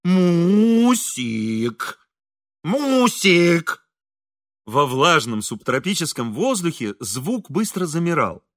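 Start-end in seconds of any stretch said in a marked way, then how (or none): 0.0-3.8 long drawn-out, higher, singing call; singsong calling. A narrator's reading. Hungry engineer calling his wife from the veranda, singsong. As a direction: call out melodiously, stretching the vowels. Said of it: "певуче призывал".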